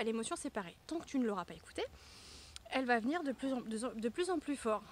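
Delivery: ordinary talk, nothing marked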